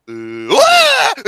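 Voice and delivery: high, loud mock "wah"; imitated wail. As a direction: silly groan jumping up into a cry. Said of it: "He's like".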